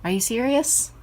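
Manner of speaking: can't believe what the pet is doing; real incredulous question to the pet; disbelief. Real, not acted. Pet ignores the food.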